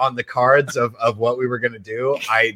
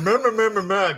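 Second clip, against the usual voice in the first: mocking mumble; 8 semitones higher than usual. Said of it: "you mumbling".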